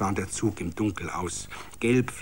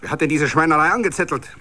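louder, fast rising bark; angry shout; 4 semitones higher, 4 dB louder, faster than usual. A narrator's reading. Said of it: "schrie ein finsterer S-A-Mann".

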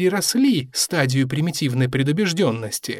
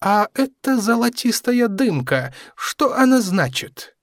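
put-on curious young crewman; 7 semitones higher than usual. A narrator's reading. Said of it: "спросил связист Фрункс".

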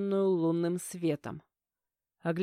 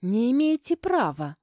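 high, loud, defiant retort; girlish outburst of protest; snapping back; 4 semitones higher, 6 dB louder than usual. A narrator's reading. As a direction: snap back indignantly. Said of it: "огрызнулась Оля".

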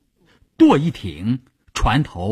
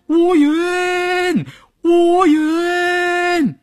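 high, long-drawn repeated calls; full-throated yell; much slower than usual. A narrator's reading. Shaoyue runs toward Wuyun's boat, yelling at full throat. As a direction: throw the name far out, voice pitched way up. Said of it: "一边跑一边扯开嗓门大叫".

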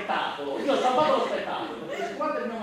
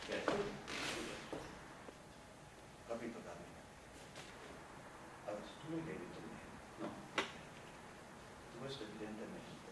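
quiet dejected muttering; 9 semitones lower, 17 dB quieter than usual. Acted. Quiet resigned mumbling.

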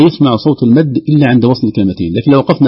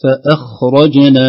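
usual speaking voice

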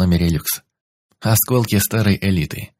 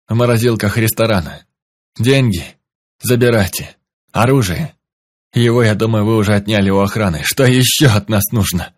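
pleading; 2 semitones higher, 4 dB louder than usual. Acted. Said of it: "жалобно всхлипывал".

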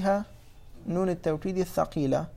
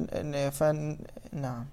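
usual speaking voice

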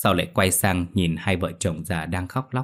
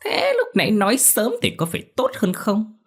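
gloating; 13 semitones higher than usual. Acted. Villain gloats over victim.

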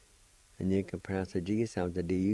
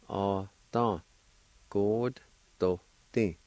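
each syllable of "Ata God Doti" drawn out slowly and evenly; slow demonstration; much slower than usual. Shown as a demonstration. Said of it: "And together".

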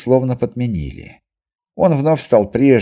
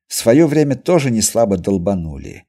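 ordinary delivery, nothing marked

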